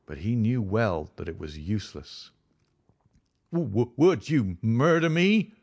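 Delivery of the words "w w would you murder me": terrified stammer, higher and louder. A narrator's reading. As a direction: stutter out the plea in terror. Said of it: "he stammered".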